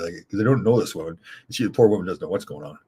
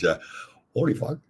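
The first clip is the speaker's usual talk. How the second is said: usual speaking voice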